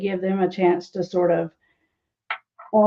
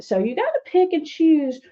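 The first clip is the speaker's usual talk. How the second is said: ordinary talk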